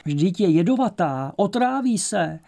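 loud, high-pitched protest; alarmed warning. A narrator's reading. Ivan objects as Myška is offered a poisonous mushroom, alarmed. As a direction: warn urgently, voice jumping up.

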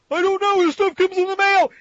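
high panicky denial; frightened editor. Acted. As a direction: cower and squeak out the excuse. Jameson cowering before Goblin.

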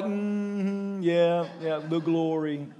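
flat, bored mumble of people in church; mock the half-hearted crowd; a listless, quiet yeah yeah; unimpressed murmur. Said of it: "people are like".

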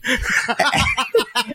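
high laugh after a punchline; real high-pitched laughter. Real, not acted.

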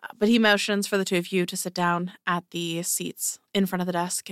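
ordinary talk

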